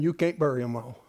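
ordinary talk, nothing marked

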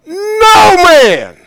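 NO MAN cried out high and loud; fervent pulpit shout on no man; shouted emphasis. Acted. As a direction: hit no man with a preacher's cry. Preaching the verse with force.

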